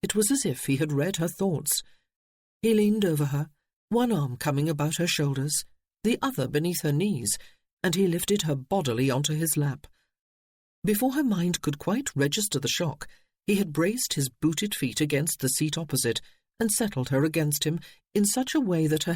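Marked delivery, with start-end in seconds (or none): none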